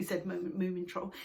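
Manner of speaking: plain reading voice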